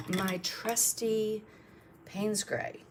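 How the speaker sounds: slow, affectionate "my trusty" stretched out; "my trusty" drawn out with mock fondness; fondly drawn out. Shown as a demonstration. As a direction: savour "my trusty" like an old friend, slowly.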